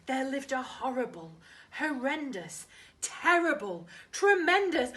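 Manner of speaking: rising from hushed to loud and high; growing dramatic crescendo; building scary climax. Acted. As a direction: build the monster list up to a near shout. Spooky story builds up.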